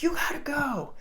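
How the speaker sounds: high-pitched urging to a surfer; urgent call. Retold or imitated. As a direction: call it out high to send a friend off. Retells urging friend onto wave.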